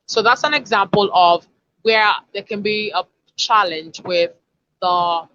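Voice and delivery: usual speaking voice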